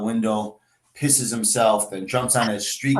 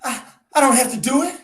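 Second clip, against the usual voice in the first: high, quick eager question; 13 semitones higher, 3 dB louder, faster than usual. Acted. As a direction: rush it out with hope. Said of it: "She asked eagerly".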